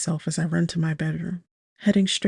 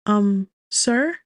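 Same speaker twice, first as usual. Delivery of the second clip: tentative call, a bit raised; 4 semitones higher, 4 dB louder than usual. A narrator's reading. Calling to man at the second door, tentative call. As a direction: call to a stranger with a questioning rise. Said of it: "I call".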